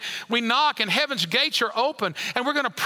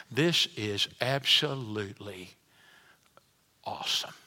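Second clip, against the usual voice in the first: hushed awe; 11 semitones lower, 5 dB quieter than usual. Retold or imitated. Believer arriving home in heaven, hushed awe. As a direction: breathe it out in quiet amazement. Imagined relief on reaching heaven.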